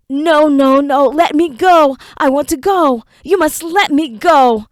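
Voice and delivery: high, loud, tearful begging; crying plea. Acted. Enoch, hooded and bound, crying to be let go. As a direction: beg for release through tears. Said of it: "Stop crying, monkey".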